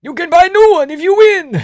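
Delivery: put-on very high loud accented yell; frantic high-pitched Georgian shout; Merab impression. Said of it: "And he's like".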